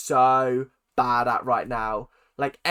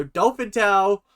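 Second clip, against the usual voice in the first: loud, stretched-out mock call; mock announcing cry; 5 semitones higher, 6 dB louder, slower than usual. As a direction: shout it slowly as if from atop a chair. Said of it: "you get up on a chair and you go".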